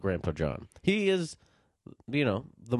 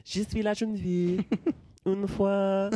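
higher, put-on French lilt; dreamy mock-French recital; playful French; 6 semitones higher than usual. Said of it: "It sounds nice in French".

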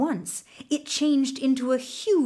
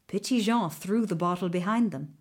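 usual speaking voice

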